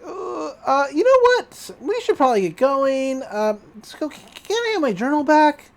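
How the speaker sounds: high, faltering, nervous excuse; nervous stammer. Retold or imitated. Boy backing away from the impostor, nervous. Dipper nervously tries to leave.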